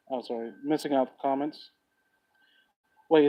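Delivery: ordinary talk, nothing marked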